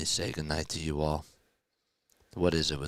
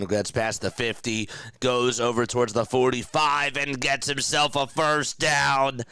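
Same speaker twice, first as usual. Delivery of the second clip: rising, louder excited sports call; play-by-play call; 7 semitones higher, 6 dB louder than usual. Real, not acted. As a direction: call the final drive live, building up.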